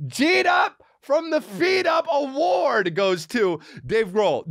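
high, stretched-out squealing delivery; hysterical excitement. Real, not acted. Retelling his dash to the SUV, high hysterical delight. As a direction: cry it out high and slow, overcome with delight.

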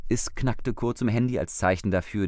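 ordinary delivery

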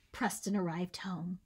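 usual speaking voice

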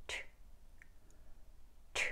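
트 as a sharp airy alveolar t; bare t burst for 트, with air rushing out; tongue on the ridge. Shown as a demonstration. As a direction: release 트 from the tongue tip on the gum ridge. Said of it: "치경 대곤".

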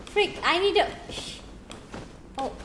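ordinary talk

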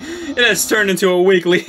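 usual speaking voice

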